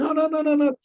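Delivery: high rushing no, no, no; eager insisting. Retold or imitated. Buyer cuts off the bank officer, high and eager. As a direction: cut him off eagerly, pitch up.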